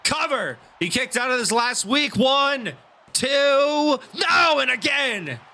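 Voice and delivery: climbing excited near-fall call; wrestling commentator. Acted. Excited near-fall pin count.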